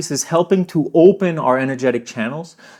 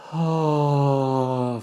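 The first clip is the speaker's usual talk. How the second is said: soft open-mouthed sigh out; audible sigh; 5 dB quieter than usual. Shown as a demonstration. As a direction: let the air go with a sigh. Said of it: "sigh it out".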